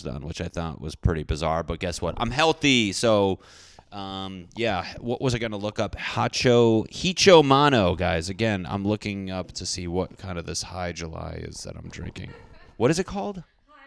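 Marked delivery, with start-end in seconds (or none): 6.0-7.9 loud exasperated name. Real, not acted. Finally looking up the name.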